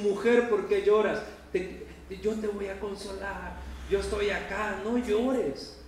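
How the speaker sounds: Jesus tenderly comforting the weeping Mary; tender consoling Jesus. Acted. Tender consolation, lifted and gentle. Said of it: "Este es un".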